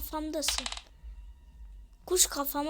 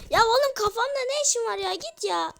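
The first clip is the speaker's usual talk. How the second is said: loud, fast, high yell at the bird; exasperated yell; 7 semitones higher, 7 dB louder than usual. Real, not acted. Yelling at the bird sitting on his head, exasperated. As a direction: shoo the pest off your head, fed up.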